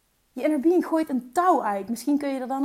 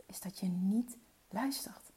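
whisper; 4 semitones lower, 8 dB quieter than usual. Real, not acted. Telling a truth in confidence.

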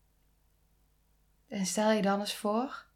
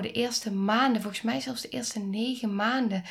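usual speaking voice

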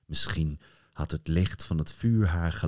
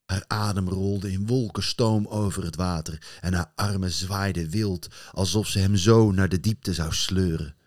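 plain reading voice

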